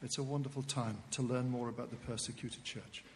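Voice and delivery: usual speaking voice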